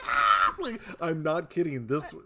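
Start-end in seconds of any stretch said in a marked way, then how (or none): 0.1-0.5 loud mock scream; loud, drawn-out "aah" played for effect; imitated scream. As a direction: act out the exploding victim's yell. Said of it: "and she goes".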